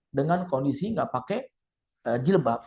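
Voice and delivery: ordinary talk, nothing marked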